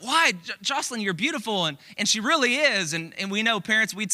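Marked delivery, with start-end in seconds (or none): 0.0-1.6 high, coaxing parent voice; pleading reassurance. Retold or imitated. Parents soothing their crying toddler, high and coaxing. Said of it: "They're like".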